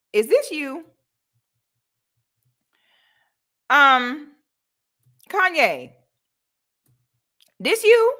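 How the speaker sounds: high, loud taunting "this you"; teasing callout; mocking taunt. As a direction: call him out, mocking each time.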